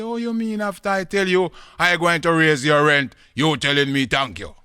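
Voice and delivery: high, loud outraged question; indignant landlord. Acted. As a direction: protest at full voice in disbelief.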